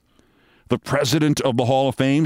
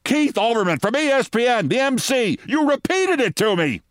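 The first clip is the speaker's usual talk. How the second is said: high, exasperated mock scream; 10 semitones higher than usual. Deputy mayor screaming at the mayor, exasperated. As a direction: yell it at him, at wit's end. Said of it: "Fran Reiter screamed".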